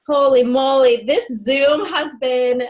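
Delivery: loud, delighted holy moly; excited exclamation. Real, not acted. Host wrapping up the Zoom, loud and thrilled. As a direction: burst out with real excitement.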